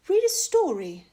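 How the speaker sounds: high, loud bright queen voice; queen's suggestion. Acted. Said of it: "suggested the Queen".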